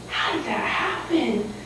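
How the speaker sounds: slow, hushed whisper of wonder; amazed whisper. Acted. Girl amazed at a magic stew, slow whisper. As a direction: whisper it to yourself in disbelief.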